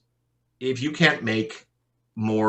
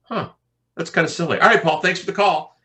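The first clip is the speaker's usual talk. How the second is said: imitating a caught-out client, higher and louder; sheepish client; 9 semitones higher, 6 dB louder than usual. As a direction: play the embarrassed caller wrapping up fast. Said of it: "awkward pause".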